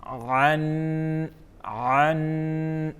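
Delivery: عن from the throat; throat sound demo. Shown as a demonstration. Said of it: "کیا بنا؟". (عن حلق سے ادا کریں)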